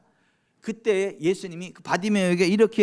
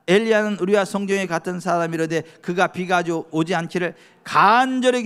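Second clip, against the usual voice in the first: louder formal scripture reading; preacher reading Hebrews aloud; 5 dB louder than usual. Acted. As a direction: proclaim the verse to the congregation.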